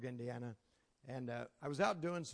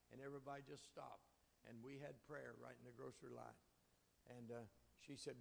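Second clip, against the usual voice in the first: much softer, intimate telling; hushed sermon storytelling; 14 dB quieter than usual. A narrator's reading. Preacher recounting praying with a stranger, hushed and tender. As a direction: draw the congregation in close and quiet.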